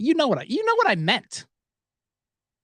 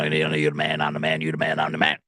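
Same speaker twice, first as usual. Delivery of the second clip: very low, monotone sports-talk guy voice; Scott Farrell impression; 10 semitones lower than usual. Praising a sports host, mimic his very low monotone. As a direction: drop deep and chant it on one low note. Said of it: "That's how he talks".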